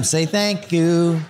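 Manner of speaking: slow, drawn-out THANK YOU; parental prompting. Acted. Father reminding his son all the time, slow prompting.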